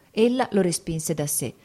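ordinary delivery, nothing marked

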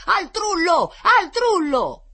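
high, very loud rallying cry; shouting. A narrator's reading. Boys shouting from the handcart, loud rallying yell. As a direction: yell it out for all to hear. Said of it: "di lassù gridavano".

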